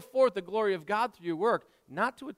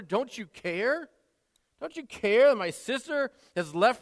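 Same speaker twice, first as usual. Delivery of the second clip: rising, louder exasperated whine; complaining; 4 semitones higher, 4 dB louder than usual. Acted. Martha grumbling to Jesus about her sister, exasperated. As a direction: pile up the grievances, getting worked up. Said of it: "A little complaint there".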